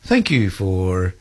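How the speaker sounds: slow, measured pilot's cabin announcement; drawn-out British Airways captain on the intercom; airline pilot announcement. Retold or imitated. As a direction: spell out the final approach, slowly and gravely. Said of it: "he said, uh".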